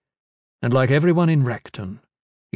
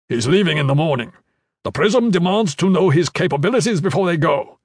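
commander; 5 semitones higher, 4 dB louder than usual. Acted. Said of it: "Iron Fist asked".

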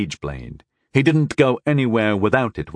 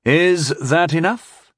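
cheerful; 7 semitones higher, slower than usual. A narrator's reading. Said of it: "I asked cheerfully".

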